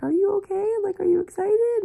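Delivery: high-pitched excited questions; imitated eager concern. Family's eager questions, retold.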